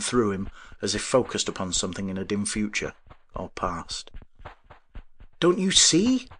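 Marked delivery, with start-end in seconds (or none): 5.4-6.3 high, loud urgent question; wondering. A narrator's reading. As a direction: plead in wonder, rising at the end. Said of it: "asked the wondering man".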